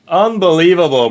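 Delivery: loud, high burst of disbelief; excited outburst. Real, not acted. Streamer yelling at the opponent's big turn. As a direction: shout it out in shock.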